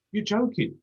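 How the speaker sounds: high, outraged disbelief; can't believe it, voice shoots up; incredulous. Real, not acted.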